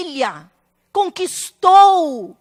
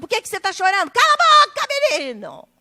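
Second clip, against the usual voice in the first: harsh scolding parent; faster than usual. Retold or imitated. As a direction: imitate a parent yelling at a child. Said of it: "E não, tipo".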